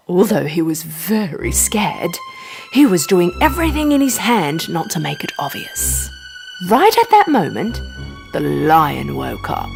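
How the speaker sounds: ordinary delivery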